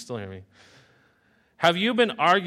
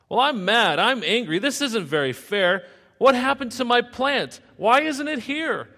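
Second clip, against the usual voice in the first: loud, higher furious complaining; angry rant; 12 semitones higher, 9 dB louder than usual. Acted. Jonah shouting at God over the lost plant, loud angry rant. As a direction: rage like a sulking child.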